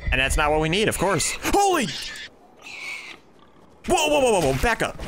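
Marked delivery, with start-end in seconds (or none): 1.4-5.0 frightened player backing away from the dragon; alarmed. Real, not acted. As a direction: panic as the dragon swoops in.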